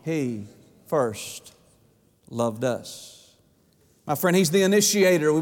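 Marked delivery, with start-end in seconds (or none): 0.0-3.3 much lower and softer, slowly spaced words; hushed climax. Acted. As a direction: drop to a reverent hush. Climax answer to his own question.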